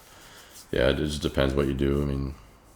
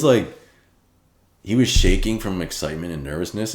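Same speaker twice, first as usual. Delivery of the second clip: ordinary delivery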